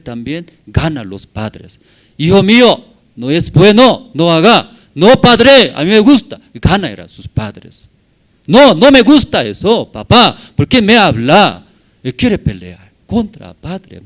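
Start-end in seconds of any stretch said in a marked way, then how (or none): 2.2-4.8 father scolding. Acted. Father scolding child in parable. 4.9-6.4 put-on defiant child. Child talking back to father. 8.5-11.6 put-on defiant child. Child defying father in parable.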